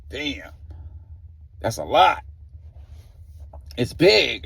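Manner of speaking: mildly awed disbelief; a touch of retold shock. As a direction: react like you're taken aback. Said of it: "He was, like, shocked".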